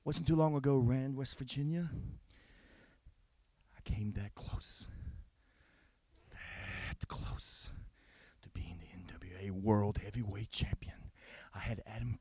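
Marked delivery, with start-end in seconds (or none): 3.8-12.2 hushed, menacing whisper; intense whisper. Acted. Wrestler whispering his grudge. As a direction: lean in and whisper the threat.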